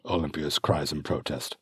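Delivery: ordinary delivery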